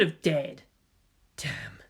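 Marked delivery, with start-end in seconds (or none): 1.4-1.8 low whispered damn; bitter curse under the breath. A narrator's reading. Gendo alone after the film, whispered.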